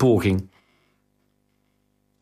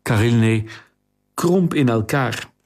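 ordinary delivery

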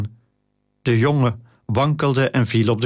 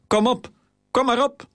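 uitdagende kreet; 12 semitones higher than usual. A narrator's reading. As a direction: goad them on in a much higher voice. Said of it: "schreeuwde hij beledigingen en bedreigingen om zichzelf moed in te spreken". (hoog, uitdagend opjutten)

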